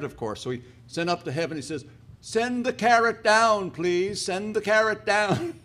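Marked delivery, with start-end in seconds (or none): 2.2-5.6 high, slow, drawn-out call. Acted. Angel calling up to heaven for the carrot, drawn out. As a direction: call to someone far above.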